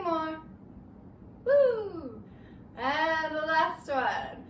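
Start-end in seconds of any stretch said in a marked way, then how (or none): 1.4-2.2 sudden yelp while losing balance; startled woo. Real, not acted. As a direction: cry out as you wobble. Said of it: "that was a, "Oh, I'm almost losing my balance" woo".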